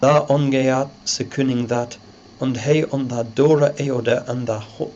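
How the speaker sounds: put-on reading the Old English text aloud. Shown as a demonstration. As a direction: recite it in Old English pronunciation. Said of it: "The text".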